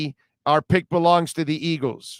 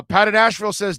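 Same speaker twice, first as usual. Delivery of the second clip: ordinary delivery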